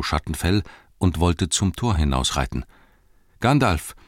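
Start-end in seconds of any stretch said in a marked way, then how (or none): 3.4-3.9 higher-pitched urgent call. A narrator's reading. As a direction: call after someone about to leave. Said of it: "rief Pippin".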